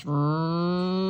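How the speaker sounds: ordinary delivery, nothing marked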